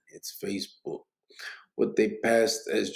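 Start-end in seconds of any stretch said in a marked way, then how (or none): 0.1-1.0 soft unvoiced whisper. Real, not acted. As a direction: whisper it to yourself. Whispered aside while reading.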